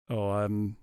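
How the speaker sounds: ordinary talk, nothing marked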